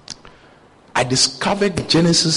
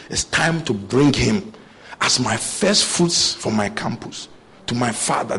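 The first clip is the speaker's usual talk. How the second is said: ordinary talk, nothing marked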